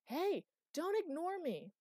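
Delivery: high, drawn-out indignant yell; shouting. A narrator's reading. Chloe to the master walking away, shouting. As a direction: holler after someone ignoring you. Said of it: "Chloe shouted".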